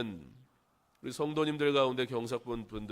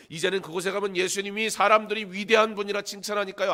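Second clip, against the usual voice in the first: high, loud, driving sermon delivery; full-force preaching; 8 semitones higher, 8 dB louder than usual. Acted. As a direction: build an urgent case to the congregation at high pitch. (높고 큰 목소리로 몰아치는 설교)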